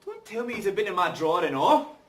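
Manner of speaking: loud, high outrage; angry. Acted. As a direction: explode at them in disbelief. Angry confrontation.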